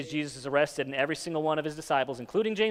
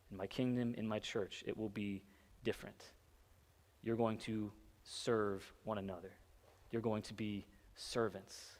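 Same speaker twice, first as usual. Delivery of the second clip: hushed earnest voicing; 5 semitones lower, 14 dB quieter than usual. Jesus's words, sermon climax.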